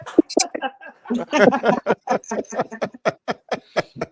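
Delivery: loud, high burst of laughter; real laughter rolling on; hearty laugh. Real, not acted. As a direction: crack up at your own joke. Laughs at his own joke.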